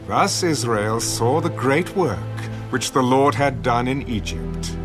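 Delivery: ordinary talk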